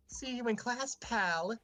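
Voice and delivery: high mocking sing-song; sly girl voice. Jenny mock-friendly threat.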